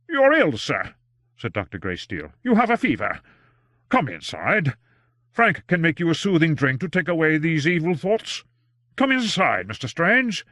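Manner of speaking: put-on worried old doctor. A narrator's reading. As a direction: plead with him kindly to come inside. Greysteele voiced by reader.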